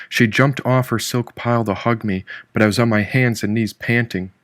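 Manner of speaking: ordinary delivery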